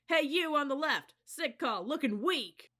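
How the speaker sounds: high, loud jeering insults; loud taunt. A narrator's reading. Cadet leader insulting passing company, loud jeer. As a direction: heckle the other company. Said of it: "directed all of the insults".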